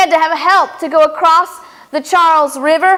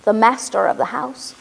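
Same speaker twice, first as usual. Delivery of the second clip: ordinary talk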